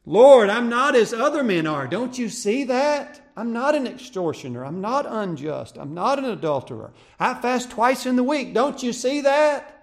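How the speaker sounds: high, loud, self-praising cry to God; boastful Pharisee. Acted. Pharisee in the temple boasting loudly to the Lord. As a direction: puff yourself up before God. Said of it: "That's what that Pharisee in the temple said".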